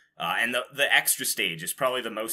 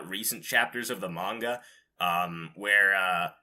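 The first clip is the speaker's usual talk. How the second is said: ordinary delivery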